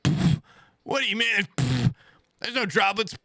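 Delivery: high-pitched mock dismissal with puffs; acted-out incredulous brush-off; dismissive friend. Retold friend brushing off droplets.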